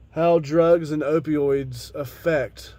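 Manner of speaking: ordinary talk, nothing marked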